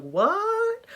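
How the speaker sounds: high, squeaky disbelief; shocked what. Acted. Moses hearing the talking bush, high shocked yelp. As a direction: yelp it in total confusion.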